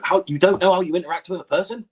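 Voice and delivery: baffled, climbing pitch; incredulous retold question. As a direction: question it as if it makes no sense. Retells disbelief at Gamer's answer.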